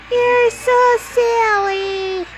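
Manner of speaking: very slow, high drawn-out coo. Real, not acted. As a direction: stretch it out sweetly to the puppy. Talking to the dog.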